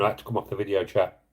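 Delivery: ordinary talk, nothing marked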